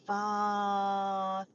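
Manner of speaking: "Farther" stretched out very long and level; struggling to pronounce. Real, not acted. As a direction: sound out "Farther" slowly, unsure of the name. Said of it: "I can't even say that".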